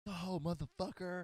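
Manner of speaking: high mock annoyed driver; imitated driver. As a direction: play a road-rage driver lightly. Voicing angry driver.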